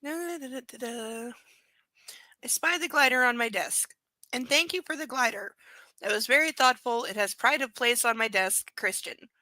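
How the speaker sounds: reading the novel aloud; lightly performed book reading; story narration. Acted.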